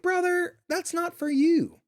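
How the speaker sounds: high, slow mock scolding of the dog. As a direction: stretch it out up high like scolding a pet. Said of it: "I'd be like".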